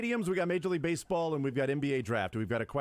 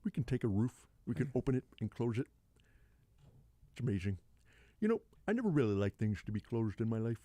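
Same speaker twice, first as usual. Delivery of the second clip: Bill Walton impression; 3 semitones lower than usual. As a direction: marvel like a dreamy old commentator. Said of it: "a Bill Walton impression".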